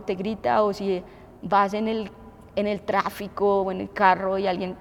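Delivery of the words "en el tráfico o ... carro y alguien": usual speaking voice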